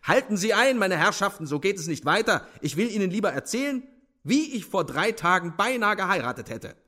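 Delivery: high-pitched, lordly holding forth; imperious officer. A narrator's reading. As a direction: take over the room from on high. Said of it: "rief allmächtig Swerkow".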